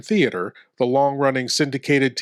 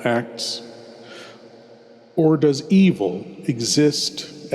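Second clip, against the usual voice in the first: horror host. Acted. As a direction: draw the listener into the dark, savouring each word. Horror host introduces tale.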